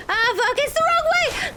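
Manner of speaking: high, loud panic; panicked shout. Real, not acted. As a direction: scream at the wrong turn. Panicked shout while fleeing.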